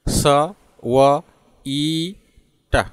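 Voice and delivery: स, व, ई, ट each said separately with pauses; slow sound-by-sound breakdown, स... व... ई... ट; broken into sounds. Shown as a demonstration. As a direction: sound out स, व, ई, ट piece by piece. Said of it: "division करेंगे?".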